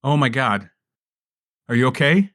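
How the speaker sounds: ordinary talk, nothing marked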